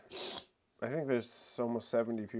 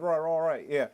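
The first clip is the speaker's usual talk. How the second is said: higher, hammed-up Australian banter; Australian accent; 6 semitones higher, 6 dB louder than usual. Showing an Australian phrase to a friend, mock Australian accent. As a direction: put on a broad Australian accent, pitched up. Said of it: "said with an Australian accent".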